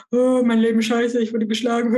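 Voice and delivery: exaggerated mock self-pity; mock lament. Speaker parodies what boss might have said, mock lament. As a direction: wail it lightly, as a parody. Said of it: "was soll ich jetzt sagen?".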